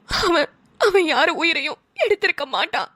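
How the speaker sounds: crying as she insists; mother crying in defense of her son; tearful plea. Acted. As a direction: voice wet with tears, defending her boy.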